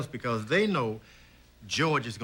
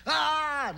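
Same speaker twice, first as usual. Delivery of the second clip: high mock scream of a scared boy; retold child scream; 14 semitones higher than usual. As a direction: squeal like a frightened little boy. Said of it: "And he's screaming".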